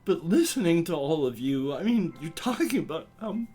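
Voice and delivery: high, halting, emotional outburst; worked up and apologetic, pitch climbing, breaking off mid-phrase; upset and embarrassed. Acted. Hurt human confronting cryptid friends at the bar, high and emotional.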